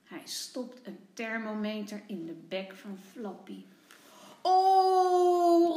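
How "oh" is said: high, loud alarmed oh; loud cry. A narrator's reading. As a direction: cry out in shock at the thermometer. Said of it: "roept Tommy".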